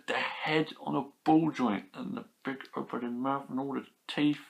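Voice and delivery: ordinary talk, nothing marked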